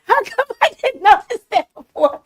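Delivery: very high, squeaky laughing voice; amused disbelief. Real, not acted. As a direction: let laughter push the voice way up. Laughing reaction to the clip.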